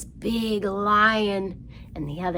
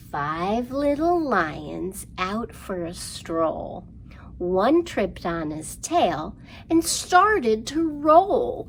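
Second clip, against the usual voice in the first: slow lively rhyme, louder on the roll and growl; rhyme for kids; 3 semitones higher, 5 dB louder, slower than usual. Acted. Librarian reciting counting rhyme to children, lively. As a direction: act out the lions tumbling.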